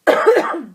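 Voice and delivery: sudden hard cough; choking cough mid-sentence; coughing fit. Real, not acted. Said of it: "I just got choked out".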